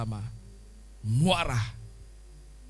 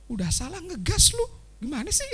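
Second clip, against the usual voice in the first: mock scolding, much higher; annoyed scolding; 9 semitones higher than usual. Someone scolding a person who is wrong but pushy, higher. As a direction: scold the guilty one for snapping. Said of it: "bilang kan ngegas".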